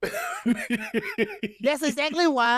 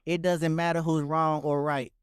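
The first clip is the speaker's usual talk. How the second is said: flat reading of lyrics; 7 semitones lower than usual. Pretend. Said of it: "that ain't how you said it".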